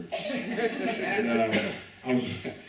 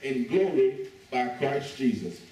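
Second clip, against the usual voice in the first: scripture reading; 3 semitones lower than usual. Acted. Reads scripture again.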